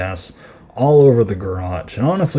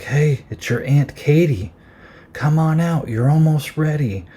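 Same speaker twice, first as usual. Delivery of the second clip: higher, mock-sweet singsong taunt; imitated playful taunt, pitched up; 6 semitones higher than usual. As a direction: coax him out as a teasing aunt. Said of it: "basically just taunting him".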